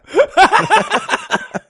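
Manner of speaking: real high-pitched cackle; high laugh. Real, not acted. Cracking up after the Anatevka joke, high laugh.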